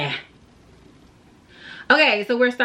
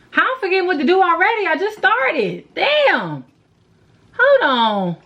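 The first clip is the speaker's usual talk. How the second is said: flustered; 9 semitones higher than usual. Real, not acted. Flustered at forgetting.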